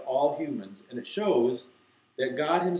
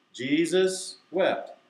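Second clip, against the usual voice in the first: higher, stretched-out recitation; slow, deliberate; 4 semitones higher, 3 dB louder, much slower than usual. Shown as a demonstration. Teacher leading the verse again, slow and deliberate. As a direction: hold each word for the group. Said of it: "I have to say it slowly, deliberately".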